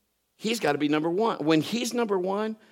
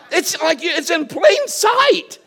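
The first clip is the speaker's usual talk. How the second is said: exasperated exclamation; 12 semitones higher, 8 dB louder, much slower than usual. Retold or imitated. Dish found in the drawer.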